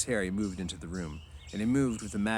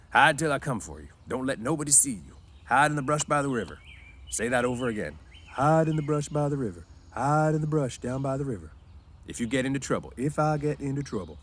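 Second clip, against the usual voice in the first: George; 6 semitones higher, 4 dB louder than usual. A narrator's reading. George drilling Lenny.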